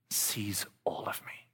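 slow soft whisper; hushed awe. Real, not acted. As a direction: breathe it out tenderly. Tender climax.

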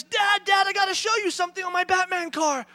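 put-on high, loud kid clamour; excited little boy. Son running to show dad his new car, high and excited. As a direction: call out as an eager child. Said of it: "Cullen comes running into the kitchen".

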